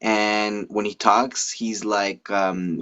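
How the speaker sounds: normal talking voice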